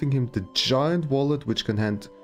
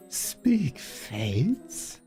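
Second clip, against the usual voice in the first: fortune teller; 6 semitones higher, 4 dB quieter than usual. Pretend. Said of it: "Fortune telling".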